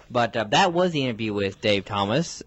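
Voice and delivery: ordinary talk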